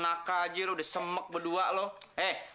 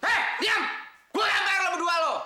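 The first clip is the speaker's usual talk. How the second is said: loud, high-pitched angry yell; angry shout; 10 semitones higher, 5 dB louder than usual. Acted. Boss silencing the two people he pays, angry shout. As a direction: shout them down furiously. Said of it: "Sudah, jangan marah-marah".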